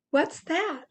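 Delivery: ordinary delivery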